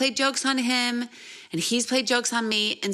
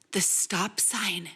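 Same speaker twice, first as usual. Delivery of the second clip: whisper; 5 dB quieter, slower than usual. Retold or imitated. Husband whispering to her.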